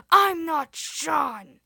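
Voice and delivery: high, very loud, slow demonic roar; demon shout. A narrator's reading. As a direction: bellow it slowly like a demon. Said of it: "he shouted".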